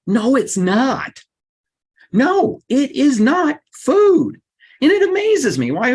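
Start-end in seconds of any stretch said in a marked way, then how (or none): 0.1-4.4 slow, high and punched, every word hit; emphatic denial. Real, not acted. To the audience, rejecting that fast food is food: slow emphatic denial. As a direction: knock the idea down hard and slow.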